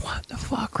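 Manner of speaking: breathy hushed curse; watching the larping, whispered; whispered disbelief. Retold or imitated. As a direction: whisper it in shock. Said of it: "and I'm like".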